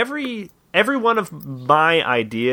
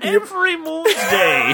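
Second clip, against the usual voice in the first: high mock cry; 12 semitones higher than usual. Joking plug for their show.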